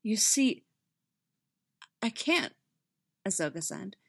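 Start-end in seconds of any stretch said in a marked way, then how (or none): 0.0-2.5 halting, with a break midway; mildly choked admission; voice hitching. A narrator's reading. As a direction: catch on the words, pitch lifting. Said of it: "her voice hitched in the middle".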